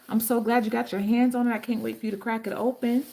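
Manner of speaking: ordinary talk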